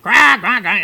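loud, high-pitched mock crow squawk; comic crow call, loud and high; crow cawing. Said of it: "the crow just went".

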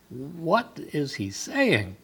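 soft, slow, bewildered wondering; puzzled Mary. Pretend. Mary wondering at the angel's greeting, soft and puzzled. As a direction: muse it aloud as a confused girl. Mary's inner question voiced.

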